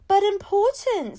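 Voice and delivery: high, loud, slow panicked cry. A narrator's reading. Ahmed protests in panic, high and loud. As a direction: blurt it out in alarm. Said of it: "looking panicked".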